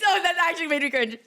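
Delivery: normal talking voice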